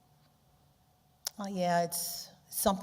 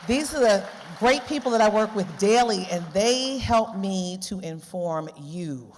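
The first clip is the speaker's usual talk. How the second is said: much louder, calling across the hall; 4 semitones higher, 10 dB louder than usual. Real, not acted. Speaker calling her colleagues to stand up, loud. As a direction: call them to their feet.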